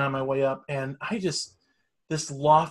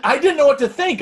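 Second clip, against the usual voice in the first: shocked; 12 semitones higher, 7 dB louder than usual. Retold or imitated. Retelling shock at old lady.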